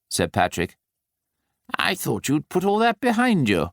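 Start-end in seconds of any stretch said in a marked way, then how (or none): 1.8-3.7 lightly voiced Nicholas, pitched higher; a hint of Nicholas, a touch reproachful; Nicholas's voice. A narrator's reading. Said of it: "said Nicholas".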